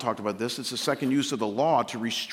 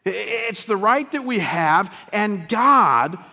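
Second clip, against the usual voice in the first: fiery preaching; 11 semitones higher, 8 dB louder than usual. Acted. Pastor rallying his congregation to engage culture, preaching at full force. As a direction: thunder it out to the congregation.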